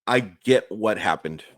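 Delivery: ordinary delivery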